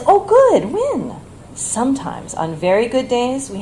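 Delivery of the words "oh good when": much higher, eager mock delight; imitating a mother's eager question; perking up. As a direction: perk up like an old mother hearing good news. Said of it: "Usually she perks up then".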